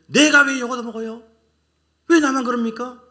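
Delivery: loud, high indignant protest; mock outraged complaint; complaining whine. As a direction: act out the sulky believer who wants to know why me. Preacher mimics complaining believer.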